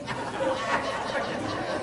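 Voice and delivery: a quiet breathy chuckle between words; soft laugh. Real, not acted. Preacher amused at naming the old sisters, quiet chuckle. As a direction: let a little laugh slip out.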